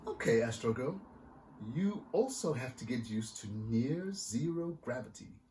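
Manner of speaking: lively picture-book reading; story narration. A narrator's reading. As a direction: read it like to a room of kids. Author reads picture book aloud.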